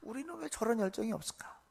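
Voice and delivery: hushed musing to oneself; inner thought. Retold or imitated. Pastor privately envies their zeal, hushed inner thought. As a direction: drop to a soft, private wondering. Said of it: "마음속으로는".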